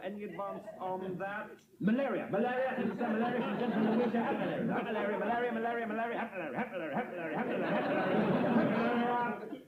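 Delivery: excited auction-room gabble, run together; auctioneer patter. Acted. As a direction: rattle the bid round the room. Auctioneer takes rapid bids.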